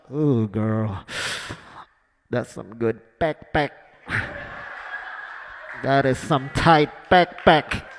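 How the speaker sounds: low, slow hammed-up bedroom croon; mock seductive. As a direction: ham it up like a cheesy lover. Pretend bedroom talk.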